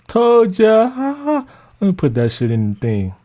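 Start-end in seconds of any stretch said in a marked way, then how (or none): 0.1-1.5 drawn-out mocking read of the comment; mocking commenter. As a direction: stretch it out like a taunting reply. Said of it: "you have to do the side laugh".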